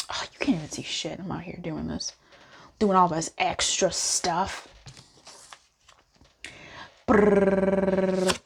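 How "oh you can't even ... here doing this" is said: softer whispered grumble. Real, not acted. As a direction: mutter it under breath.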